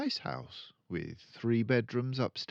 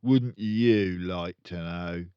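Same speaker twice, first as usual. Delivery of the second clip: slow, louder, smug taunt; smug tease; 6 dB louder, slower than usual. Acted. Father taunting Matilda, slow smug tease. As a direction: dangle the secret teasingly. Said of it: "the father said".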